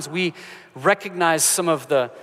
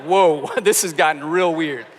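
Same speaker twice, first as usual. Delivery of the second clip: laughing; 4 dB louder, slower than usual. Real, not acted. Laughs at son's accusation.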